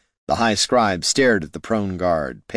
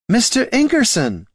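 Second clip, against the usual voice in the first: much higher, louder call of the name; shout; 5 dB louder than usual. Acted. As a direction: cut him off with a shout. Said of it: "the ranger shouted".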